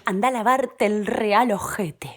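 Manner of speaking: hissed Spanish curse; muttered insult. Acted. Woman cursing her English lover in Spanish. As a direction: spit it under your breath.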